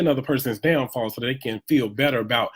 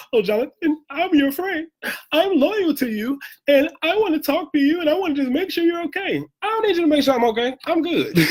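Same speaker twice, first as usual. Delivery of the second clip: much higher, sugary fake-concern voice; mocking fake friend. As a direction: parody the fake friends who show up. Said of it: "They be like".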